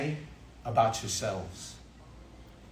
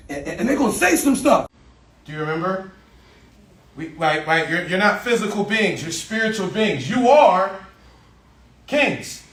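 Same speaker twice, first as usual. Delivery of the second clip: louder and higher, building to kings; rising pep shout; 6 semitones higher, 11 dB louder than usual. Real, not acted. Coach firing up his boys, loud and rising.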